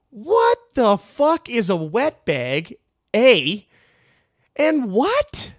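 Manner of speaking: loud, high, slow disbelief; baffled outburst, each word stretched and pushed; incredulous. Retold or imitated. Lifter reacting to a baffling insult, loudly incredulous.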